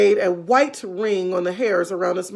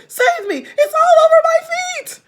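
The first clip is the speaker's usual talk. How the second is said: very high, loud laughing shriek; panicked shriek; 9 dB louder than usual. Acted. Mrs Twit screaming about the frog on her feet. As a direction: shriek in mock terror.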